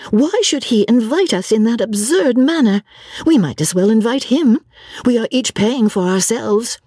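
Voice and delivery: put-on high, girlish complaining voice; exasperated girl, pitched well up; vexed young girl. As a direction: protest indignantly in a high-pitched voice.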